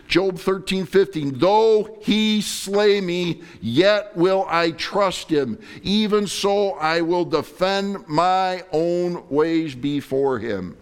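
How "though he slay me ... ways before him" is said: slow, loud, solemn scripture reading; preaching scripture. Acted. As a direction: lift each word of the verse. Reads Job slowly, preaching.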